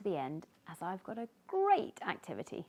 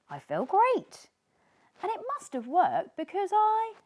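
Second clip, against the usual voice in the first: louder, higher happy boast; delighted; 12 semitones higher, 9 dB louder than usual. Acted. Sneezy telling Tiny he's cured, louder and delighted. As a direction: burst with pride.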